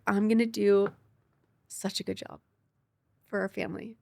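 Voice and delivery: a touch of tearful whisper; retold through tears. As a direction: voice falling away as she relives crying. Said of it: "and I started crying".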